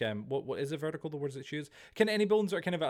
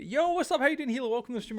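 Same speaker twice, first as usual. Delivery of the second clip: excited greeting; 10 semitones higher, 5 dB louder than usual. Real, not acted. Greeting a chatter on stream.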